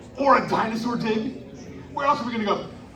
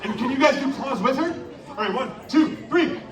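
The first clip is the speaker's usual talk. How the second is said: normal talking voice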